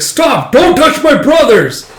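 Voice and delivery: loud, high, drawn-out yell; furious protective shout. Acted. Fighter as soldiers grab his brothers, desperate furious shout. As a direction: yell to defend your brothers.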